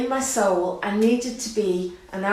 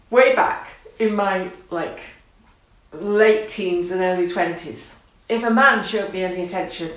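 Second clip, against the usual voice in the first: ordinary talk, nothing marked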